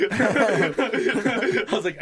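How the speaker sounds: ordinary talk, nothing marked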